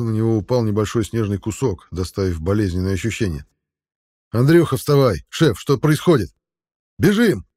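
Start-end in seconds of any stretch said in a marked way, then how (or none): 4.3-6.3 alarmed shout. A narrator's reading. Waking comrade amid disaster. 7.0-7.5 sharp high yell to flee; shout. A narrator's reading. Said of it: "успел крикнуть Денис".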